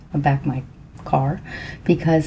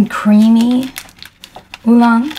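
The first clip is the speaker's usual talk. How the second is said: eager, rising, louder; excited; 4 semitones higher, 6 dB louder, slower than usual. Real, not acted. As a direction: savor the words with delight. Excited about milk oolong.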